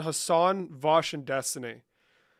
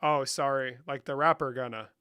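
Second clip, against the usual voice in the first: ordinary delivery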